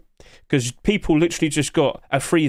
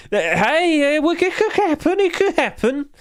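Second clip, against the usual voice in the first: very high squeaky voice; mock hopefulness; 12 semitones higher than usual. Streamer defends a wild skin wish, squeaky mock hope.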